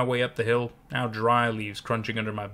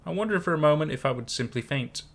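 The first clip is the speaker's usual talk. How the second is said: ordinary delivery, nothing marked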